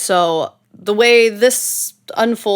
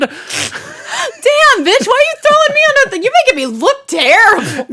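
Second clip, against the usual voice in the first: mock outraged shout; 5 dB louder than usual. Mock outrage at being blamed.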